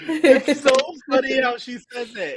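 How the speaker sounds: real laughter breaking into the words; laughing. Real, not acted. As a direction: giggle helplessly through the sentence. Laughing at the line just retold.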